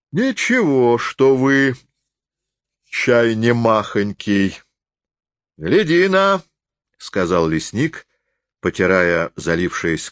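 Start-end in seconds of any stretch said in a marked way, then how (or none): 0.1-6.4 high, drawn-out rustic character voice; drunken forester, goading. A narrator's reading. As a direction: stretch the words out high and boastful. (пьяный лесник, высоко и врастяжку)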